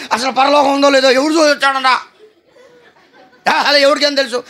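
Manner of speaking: high, loud, quick scoffing rant with a laugh; mock angry outburst. Woman angrily dismissing heaven to the preacher, mock furious. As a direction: scoff hotly, as if heaven were a rumour. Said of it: "ఆ కోపంలో, ఆవేశంలో ఏమంటుందో".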